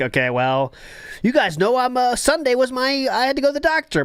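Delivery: a light impression of a streamer making excuses; imitating DSP excuse. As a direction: fake an innocent excuse.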